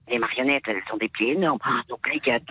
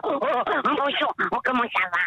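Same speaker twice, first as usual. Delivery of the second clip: puppet voice; 10 semitones higher than usual. Shown as a demonstration. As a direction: chirp it like a kids' TV puppet. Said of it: "ma voix de marionnette".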